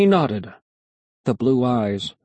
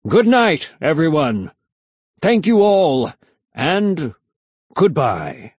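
showman's farewell; 5 semitones higher, 4 dB louder, slower than usual. A narrator's reading. Magician's farewell to the audience.

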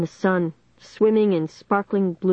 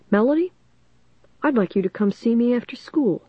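higher-pitched stern teacher summons; Mister Northwood, stern; 5 semitones higher than usual. A narrator's reading. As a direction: sternly call a pupil to account, pitched up. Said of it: "Mister Northwood said sternly".

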